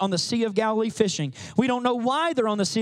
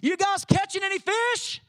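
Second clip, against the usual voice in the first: higher, light friendly call from the shore; Jesus calling out; 9 semitones higher than usual. Retold or imitated. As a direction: ask the fishermen casually from afar. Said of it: "He uses the same words Jesus does".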